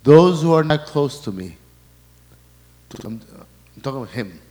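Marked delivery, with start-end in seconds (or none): 0.0-1.6 preaching. Acted. Preaching at full force.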